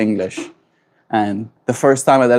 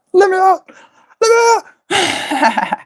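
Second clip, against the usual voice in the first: very high, desperate cry to be let out; trapped voice; slower than usual. Pretend. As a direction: squeal like someone stuck inside a box. Trapped in a little box.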